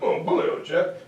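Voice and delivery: lightly voiced British shrug; a breezy touch of British gent; mock British accent. British shrugging off Keynes, in a light British accent. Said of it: "the British are like".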